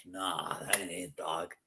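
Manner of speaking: mumbled under the breath, done with it; low deadpan dismissal. Retold or imitated. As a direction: drop the voice low and wave it off. Inner reaction to Bronco Country chant.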